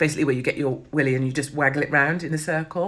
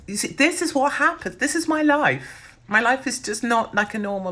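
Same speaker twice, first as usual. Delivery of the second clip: exasperated; 8 semitones higher than usual. Real, not acted. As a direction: wail it in comic exasperation. Exasperated about her life.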